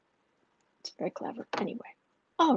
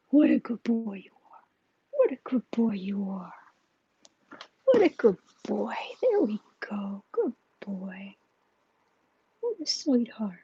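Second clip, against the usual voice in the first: cooing to pet. Real, not acted. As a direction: coo gently to a beloved pet. Cooing praise to her pet.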